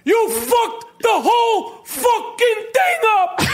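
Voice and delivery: high-pitched, drawn-out furious shouting; hammed-up enraged team boss tirade; Danny Aiello. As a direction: chew someone out in a slow, high yell. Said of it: "If Danny Aiello was, uh, owned the Patriots, he'd be saying this".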